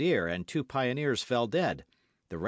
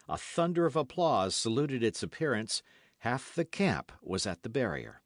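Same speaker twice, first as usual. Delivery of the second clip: usual speaking voice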